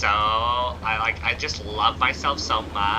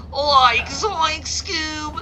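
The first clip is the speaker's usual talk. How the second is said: much higher, cartoon stoner yelp; Shaggy impression; 7 dB louder, much slower than usual. Shown as a demonstration. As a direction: squeak it out like the scared cartoon sidekick. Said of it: "My Shaggy".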